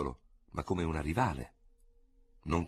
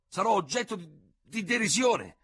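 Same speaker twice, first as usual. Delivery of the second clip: much higher, louder aghast protest; stunned outraged general; 4 dB louder than usual. A narrator's reading. Ferraud aghast at his sentence, high outraged protest. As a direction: cry out in disbelief at the absurdity. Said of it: "con accenti di profonda e sbigottita convinzione".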